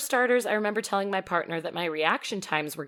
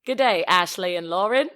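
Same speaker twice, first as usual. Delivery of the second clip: louder, hammed-up Aussie greeting; mock Australian accent; 6 dB louder than usual. Host jokingly greeting hosts as Australian listener, mock Aussie accent. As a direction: try on a bad Aussie accent. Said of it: "do your Australian dialect".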